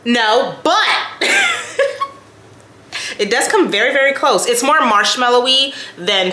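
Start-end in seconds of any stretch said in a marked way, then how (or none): 0.9-2.1 squeaky little laugh; quick high-pitched laugh; high giggle. Real, not acted. Laughing at own joke.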